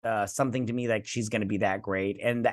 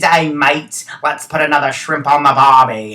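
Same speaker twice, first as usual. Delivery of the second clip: loud, hammed-up Australian accent; mock Australian accent; 11 dB louder than usual. Shown as a demonstration. Host showing off a fake Australian accent to listeners, hammed-up mock Aussie. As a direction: put on a joke Aussie accent. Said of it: "if I did an Australian accent".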